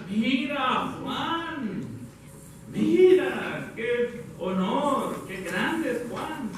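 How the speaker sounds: hammed-up hushed wonder at someone great; awestruck admiration. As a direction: imitate admirers marvelling, stretching each word. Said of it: "Querían que los demás los admiraran".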